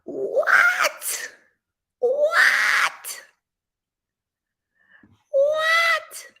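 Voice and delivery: very high squealed what; shocked what. Acted. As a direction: squeal in disbelief. Shocked girlfriend reacts.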